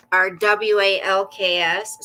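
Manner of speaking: W A L K S spelled letter by letter. Shown as a demonstration. Owner spelling W A L K S so her dog won't hear the word. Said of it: "I have to spell it".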